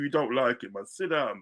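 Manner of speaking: ordinary talk, nothing marked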